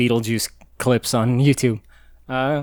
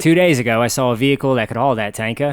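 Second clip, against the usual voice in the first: quoting Mad Max in a mock Australian accent; a light Aussie movie voice; hint of Mad Max Australian; 2 semitones lower, faster than usual. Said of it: "I can only do Australian if I'm directly quoting Mad Max".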